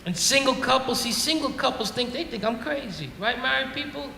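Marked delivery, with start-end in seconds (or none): none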